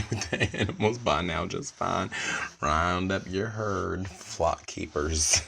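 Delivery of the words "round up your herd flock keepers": mock proclamation. Mock command to zookeepers.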